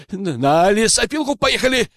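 high-pitched yelp; panicked wail. A narrator's reading. Poacher confesses under interrogation, high wail. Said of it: "завопил браконьер".

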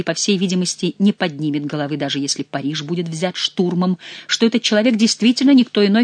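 usual speaking voice

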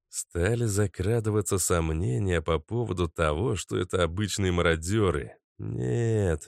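ordinary delivery, nothing marked

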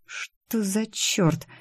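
very slow, soft half-whisper; stunned whisper. A narrator's reading. Employee finds the photos in the folder, stunned whisper. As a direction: breathe it out slowly in disbelief. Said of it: "прошептала я".

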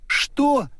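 high startled shriek; shocked cry. Acted. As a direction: cry out in disbelief. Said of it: "вскрикнула Дорогая". (изумлённый вскрик)

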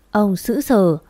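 plain reading voice

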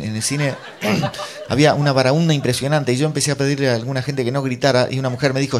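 normal talking voice

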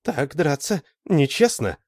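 high, slow, scornful verdict; scornful reproach. A narrator's reading. Lita condemns Alan's blow, scornful reproach. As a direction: reproach slowly, with contempt. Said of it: "взглянула на него с презрением".

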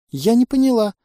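высоко и громко, растерянно; пискни как испуганное существо; тонкий писк пленницы. Acted. Пищит инопланетная пленница. (пленница-инопланетянка высоко и растерянно пищит)

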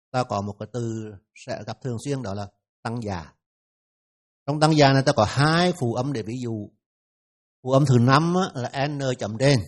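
ordinary talk

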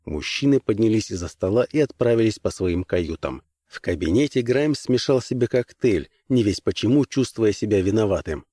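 ordinary delivery, nothing marked

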